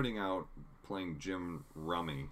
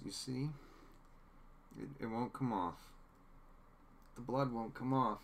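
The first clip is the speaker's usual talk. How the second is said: distraught; 5 semitones higher than usual. Retold or imitated. Newsom's breakdown quoted.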